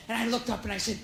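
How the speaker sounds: normal talking voice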